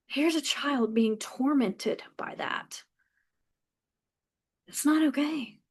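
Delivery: slow, whispered and troubled; hushed dismay. Real, not acted. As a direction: breathe it out in disbelief. Hushed dismay after the quote.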